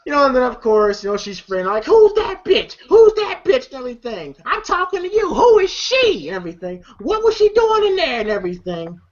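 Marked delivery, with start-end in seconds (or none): 1.8-3.7 high, louder demanding mock screech; mock jealous shriek. Girlfriend confronting Craig, high mock screech. 4.4-6.3 mock jealous shriek. Girlfriend confronting Craig, high mock screech. 7.0-8.2 mock jealous shriek. Girlfriend confronting Craig, high mock screech.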